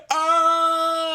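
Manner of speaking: very high, loud strained scream; mock yell. Imitating Nick Frost on the ground firing, a high scream. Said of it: "laying on the ground going".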